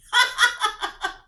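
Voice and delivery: high, loud burst of laughter. Real, not acted. Reacting to a headline on loud women, laughing. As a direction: laugh out in disbelief.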